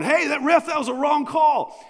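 high, loud mock shout; yelling at the ref. Soccer player yelling at the referee over a call, shouting. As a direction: holler it like an angry soccer player. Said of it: "yelling and hollering".

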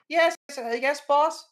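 high, loud, eager secretary voice; receptionist on the phone. Acted. Receptionist calling the editor about visitors, high eager voice. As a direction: answer the boss brightly and eagerly. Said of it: "into the phone and goes".

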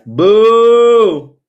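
sudden high, loud mock boo; playful boo. Teasing herself about crying with a sudden loud boo. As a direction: jump at them with a teasing boo.

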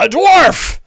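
high, loud shout of alarm; startled orc. Acted. Orc spots a dwarf standing up, startled yell. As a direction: cry out in shock as an orc. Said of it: "you see the orc's eyes go wide".

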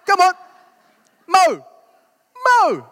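high, loud East End mate's call. Retold or imitated. East End mates urging Mo onto the ice, loud high call. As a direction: yell him over the ice, cheerful. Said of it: "that's how they talk".

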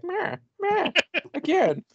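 high squeaky rat bleats; rat noises. Pretend. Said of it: "Rat ghoulie like".

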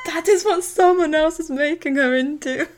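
loud, high, hammed-up indignation; acted mother's outburst; Pam's angry outburst. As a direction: throw it out as an outraged retort. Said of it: "Pam's angry".

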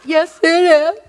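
high, loud comic whimper; mock self-pity. Wife falls for sponsor's sympathy, mock self-pitying wail. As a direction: wail agreement like a pitiful baby.

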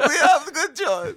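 put-on very high, loud, slow Borat-style accent; Russian accent. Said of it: "your Russian accent sounds like slightly, uh, like Borat".

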